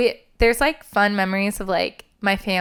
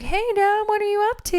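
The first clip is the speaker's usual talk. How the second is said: innocent voice to dad; 13 semitones higher than usual. Retold or imitated. Retold April Fools call to dad.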